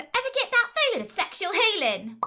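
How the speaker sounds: high, loud perky British game-heroine voice; Tracer impression. Showing a friend her Tracer tick, high perky voice. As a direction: chirp it like a plucky video game heroine. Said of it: "that changed to".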